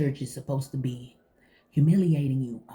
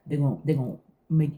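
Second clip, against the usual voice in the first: usual speaking voice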